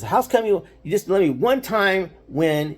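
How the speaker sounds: high, pleading sulky whine; pouty little brother plea; whiny kid brother. Retold or imitated. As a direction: beg like a kid who always loses. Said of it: "he would get so mad".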